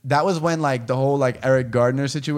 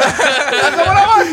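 laughing; 13 semitones higher, 7 dB louder than usual. Real, not acted. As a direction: repeat it while cracking up, high and loud. Repeat dissolving into laughter.

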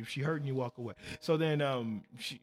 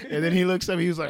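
ordinary talk, nothing marked

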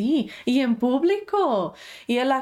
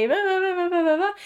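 mimicking a tantrum; 9 semitones higher, faster than usual. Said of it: "si tu hijo te está gritando".

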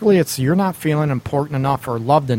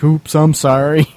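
louder, playful oops apology; sheepish mock apology; 5 dB louder than usual. Host joking as the guy who finished early, sheepish mock apology. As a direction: toss out a mock-sheepish sorry.

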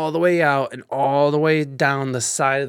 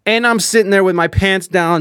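usual speaking voice

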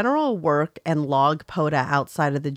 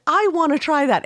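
put-on eager kid; 12 semitones higher, 6 dB louder than usual. Voicing an eager kid in line.

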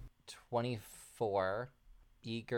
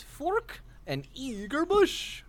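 character voice; 6 dB louder, much slower than usual. Acted.

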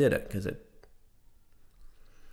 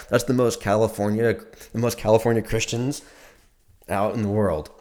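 ordinary talk, nothing marked

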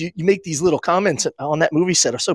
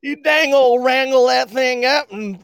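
mock Texan accent; 10 semitones higher than usual. Mock Southerner after Texas remark.

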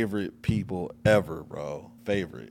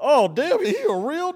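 amazed exclaim; 5 dB louder than usual. Retold or imitated. Retold amazed reaction, much higher.

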